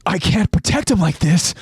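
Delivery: cursing in alarm, calling out to a comrade; alarmed outburst. A narrator's reading. Reiner reacts to approaching horde.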